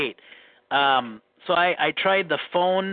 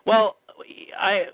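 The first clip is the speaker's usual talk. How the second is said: normal talking voice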